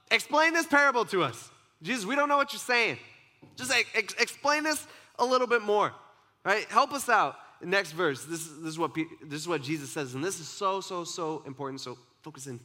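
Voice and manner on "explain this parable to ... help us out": much higher and louder, blurting plea; loudmouth Peter. Retold or imitated. Peter loudly begging Jesus to explain the parable. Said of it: "Peter speaks up... he's the loudmouth".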